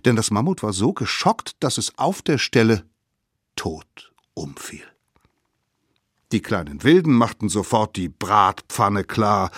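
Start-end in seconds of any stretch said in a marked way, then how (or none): 3.5-4.8 slow, near-whispered narration; hushed reveal. A narrator's reading. Narrator telling of the shocked mammoth's death, hushed voice. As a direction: let it drop away in suspense.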